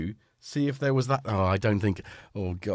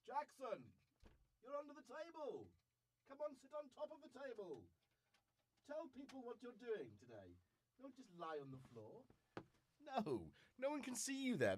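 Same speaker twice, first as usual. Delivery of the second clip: higher sing-song voice to the dog; talking to the dog; 8 semitones higher, 21 dB quieter than usual. Real, not acted. To Jackson the dog under the table, coaxing pet talk. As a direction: coax the dog up onto the table.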